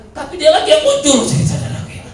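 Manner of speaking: loud, high, laughing through the words; can't hold back the laugh while telling it; high-pitched laughing retelling. Real, not acted. Laughing at the returning mourner.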